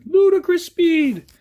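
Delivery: high, loud, drawn-out mock battle cry; hammed-up shout. Shouted catchphrase after saying ludicrous.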